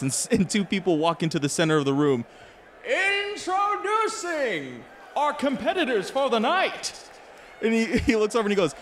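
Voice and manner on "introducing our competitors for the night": booming fight announcer, drawn out; ring announcer. Acted.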